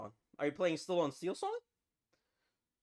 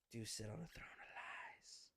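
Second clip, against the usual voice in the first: hushed, voiceless whisper; very soft whisper, all breath; mock accusing whisper; 7 semitones lower, 18 dB quieter than usual. Movie line repeated as whisper.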